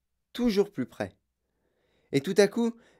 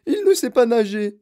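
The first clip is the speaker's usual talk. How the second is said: affolée; 8 semitones higher, 7 dB louder than usual. Acted. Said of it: "Mme Gloop affolée".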